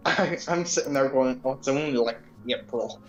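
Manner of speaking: ordinary delivery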